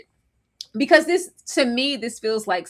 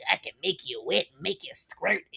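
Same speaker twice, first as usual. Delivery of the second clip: soft, low mimicked come-on; hushed, put-on dirty murmur; mock whispering; 3 semitones lower, 8 dB quieter than usual. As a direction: mutter it like a creepy seducer. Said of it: "whispering in my goddamn camera".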